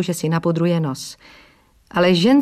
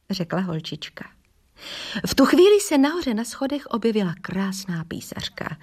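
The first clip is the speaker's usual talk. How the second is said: usual speaking voice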